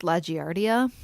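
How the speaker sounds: deadpan wrong correction, saying La Giardia with a J; mock correction. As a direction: say "Giardia" as jee-AR-dee-a. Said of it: "it's actually pronounced".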